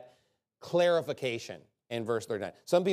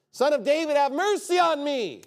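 high, loud desperate cry for mercy; screamed plea; 12 semitones higher, 6 dB louder than usual. Acted. Blind man crying out to Jesus, a loud desperate plea. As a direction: beg it out at the top of your voice. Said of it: "This is what they scream and they yell".

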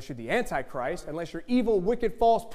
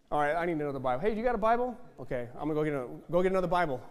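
ordinary talk

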